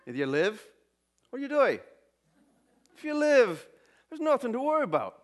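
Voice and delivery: high, loud Irish brogue joke telling; Irish accent. Shown as a demonstration. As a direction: tell the Irish joke in a thick Irish brogue, building to the punchline. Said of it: "I was gonna do it in my Irish accent".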